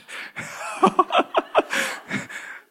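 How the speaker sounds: a rising real laugh he can't hold; high laughing fit. Real, not acted. Preacher cracking up at his own joke, high laugh.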